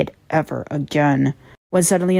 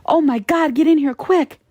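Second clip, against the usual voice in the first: high, urgent cry for help; a lightly voiced panicked scream; 9 semitones higher than usual. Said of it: "I could hear screaming".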